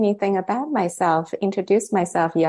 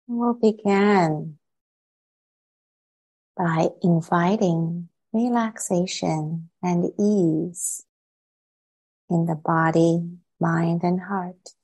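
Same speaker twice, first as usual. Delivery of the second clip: unhurried meditation guidance with long silences between phrases; calm, spacious meditation voice; guided meditation. Real, not acted. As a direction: ease listeners into stillness, phrase by phrase.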